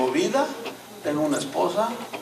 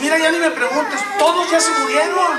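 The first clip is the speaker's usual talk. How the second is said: high, raised-voice retold exclamation; exasperated outburst; 9 semitones higher, 5 dB louder than usual. Friend telling him the whole group died, exasperated and loud. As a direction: throw it out loudly to end the questioning.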